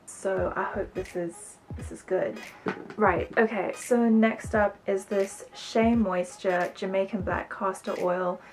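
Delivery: ordinary talk, nothing marked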